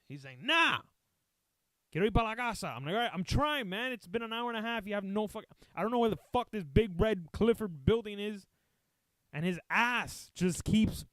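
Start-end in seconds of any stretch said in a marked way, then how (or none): 0.4-2.7 put-on high, loud old-man protest; refusing old man. Old man refusing in retold story. 2.9-8.5 high-pitched, frustrated pleading; exasperated. Retold or imitated. Retold exasperated plea while driving.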